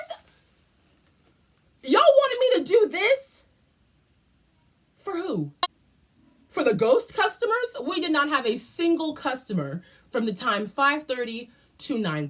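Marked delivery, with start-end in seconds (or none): 1.8-7.8 mock outrage. Mocking pirate-suited coworker.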